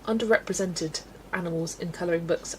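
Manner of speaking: ordinary talk, nothing marked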